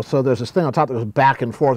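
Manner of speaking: ordinary talk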